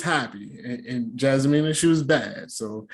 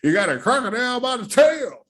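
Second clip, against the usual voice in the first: high, hollered country warning; mock dad's yell, rising high on the last word; imitating her dad; 10 semitones higher than usual. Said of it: "her dad said it".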